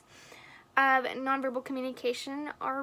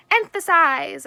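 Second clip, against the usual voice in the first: Emphasize punched much louder; Emphasize stressed forcefully, loud and deliberate; emphasized word; 3 semitones higher, 13 dB louder, slower than usual. Shown as a demonstration. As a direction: hit Emphasize hard and loud as a demo. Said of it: "I'm emphasizing the word emphasize".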